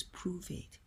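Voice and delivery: a cold, whispered challenge; harsh voice. Retold or imitated. The harsh voice of religion taunting, in a cold whisper. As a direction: hiss it as a quiet taunt. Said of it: "and the other says".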